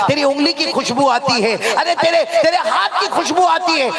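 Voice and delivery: high, mounting praise to the cook; gushing flattery. Acted. Husband flatters wife over biryani, gushing.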